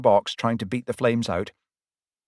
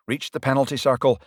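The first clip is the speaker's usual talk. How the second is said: usual speaking voice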